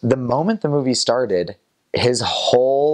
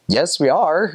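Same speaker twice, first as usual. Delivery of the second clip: insistent retort; 8 semitones higher than usual. Retold or imitated.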